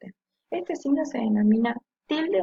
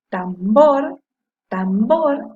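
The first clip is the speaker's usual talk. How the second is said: tambor with BOR punched loud; stressed last syllable; 7 dB louder, much slower than usual. Shown as a demonstration. Teacher showing the tonic syllable, tambor with a loud BOR. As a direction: lean hard on the final bor of tambor. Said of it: "la sílaba que suena más fuerte es bor".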